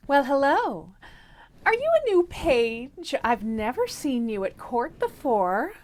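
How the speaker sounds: coy princess. Acted. Princess flirting with stranger.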